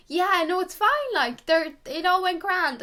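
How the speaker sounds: high, chirpy reassurance; high chirpy casual piercer; breezy piercer. Retold or imitated. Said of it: "she was like".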